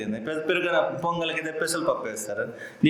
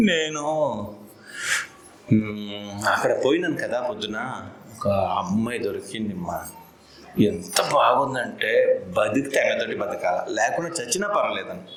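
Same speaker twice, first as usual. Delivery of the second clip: low-pitched, lightly acted lovesick confession; lovesick son; 6 semitones lower than usual. Son admits to his mother he found a girl, mildly lovesick. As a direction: confide it low, hesitating at the start.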